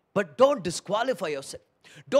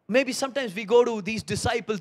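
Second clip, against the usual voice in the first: ordinary talk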